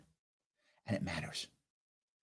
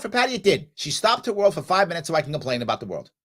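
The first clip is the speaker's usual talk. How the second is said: ordinary delivery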